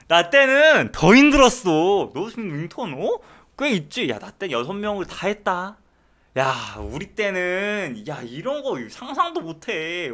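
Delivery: hammed-up back-in-my-day tirade; blustering senior resident. Residents lecturing a whining intern about their own time, hammed-up bluster. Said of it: "레지던트 하고 있는 동기들이".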